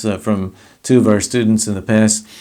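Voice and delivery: usual speaking voice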